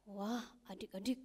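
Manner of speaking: usual speaking voice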